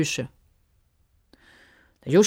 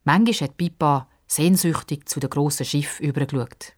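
plain reading voice

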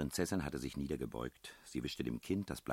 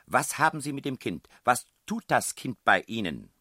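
loud, high accusing questions; angry demand; 10 semitones higher, 12 dB louder than usual. A narrator's reading. Headmistress challenges strangers about child, loud and angry. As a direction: demand answers as an indignant headmistress.

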